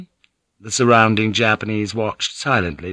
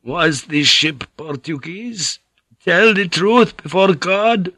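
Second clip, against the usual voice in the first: put-on higher, urgent old monk demanding; a frail old monk pressing for truth; suspicious old priest; 8 semitones higher, slower than usual.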